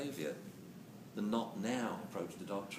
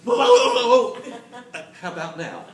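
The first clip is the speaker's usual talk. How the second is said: loud, much higher, laughing whoa then how about now; mock the hopeful male dog through laughter; male dog's whoa; 11 semitones higher, 16 dB louder than usual. Male dog ignoring the warning, laughing and loud. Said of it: "a lot of male dogs like".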